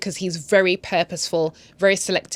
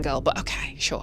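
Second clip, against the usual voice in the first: normal talking voice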